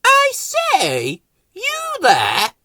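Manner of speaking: a prim flower calling over; high haughty call; Rose calling out. Acted. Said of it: "Rose called out".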